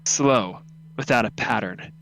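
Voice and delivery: ordinary talk, nothing marked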